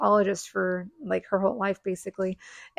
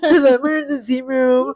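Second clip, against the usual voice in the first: loud stuffed-nose imitation; comic nasal kid mimicry, loud; nostrily voice; 7 dB louder than usual. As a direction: speak as if both nostrils are plugged. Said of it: "the nostrily kind of voice".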